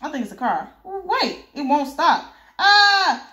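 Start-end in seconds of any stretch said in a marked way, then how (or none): 0.8-2.3 much higher, alarmed cry; rising panic. Acted. A henchman watching a car that won't stop, panicking. 2.6-3.3 loud, high shriek; terrified scream. Acted. A henchman about to be hit by a car, screaming.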